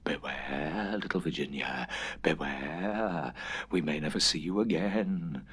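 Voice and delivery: low, soft eerie murmur. A narrator's reading. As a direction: murmur it like creatures in the dark.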